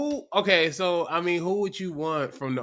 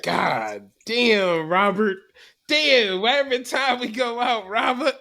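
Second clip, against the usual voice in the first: exasperated embarrassed wife; 4 semitones higher, slower than usual. Retold or imitated. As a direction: scold him like a mortified wife. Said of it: "She was just like".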